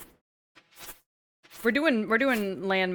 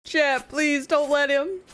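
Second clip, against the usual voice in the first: high, loud hammed-up cries for help; mock panic; 6 semitones higher, 4 dB louder, slower than usual. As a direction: plead in playful terror. Mock pleas for rescue.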